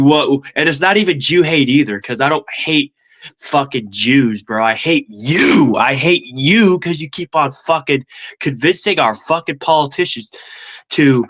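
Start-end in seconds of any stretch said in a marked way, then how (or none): 4.6-6.8 "you" stretched long and leaned on; stress on YOU. Real, not acted. Streamer turns on the pundit he resents, stress on "you". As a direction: hit "you" hard, drawing the vowel out.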